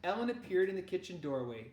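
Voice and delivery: plain reading voice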